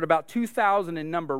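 ordinary delivery

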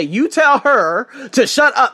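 loud, slow, drawn-out command; Kevin Hart bit. Retold or imitated. A hint of Kevin Hart telling the teacher off. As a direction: bark it like a comedian's punchline. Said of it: "that's the Kevin Hart".

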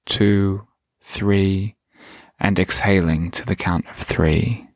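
slow, calm meditation voice; guided meditation. Acted. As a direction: guide the listener gently inward.

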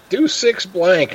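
high, slow, imitating barely talking through laughter; retold helpless laughter. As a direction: mimic yourself struggling to say it while cracking up. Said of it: "I could hardly talk. I'm laughing so hard".

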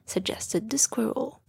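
usual speaking voice

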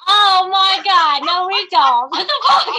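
high, loud laughing denial; laughing protest. Real, not acted. Friend mocks their accent, she laughs in protest. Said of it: "y'all talk like this".

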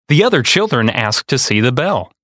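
plain reading voice

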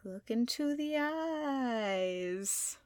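slow, drawn-out, soft mock hypnotist voice; spooky hypnotic command. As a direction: stretch each word like you're casting a spell. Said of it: "And I'm like".

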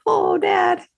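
put-on high, childlike plea; milk it with a sad little whine; whining little girl. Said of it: "And I'm like".

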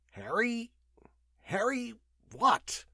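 high, drawn-out puzzling; puzzled, stretching each word as he thinks; searching memory. A narrator's reading. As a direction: grope slowly for the missing name, pitched high.

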